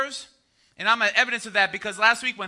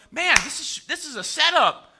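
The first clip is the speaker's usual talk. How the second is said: raised, emphatic exclamation; mock realization; 5 semitones higher, 4 dB louder than usual. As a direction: react as a reader catching on. Acting the reader's reaction.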